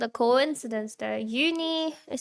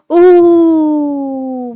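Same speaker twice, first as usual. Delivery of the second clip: excited ooh; 4 semitones higher, 16 dB louder than usual. Real, not acted. Reacting to food on stream.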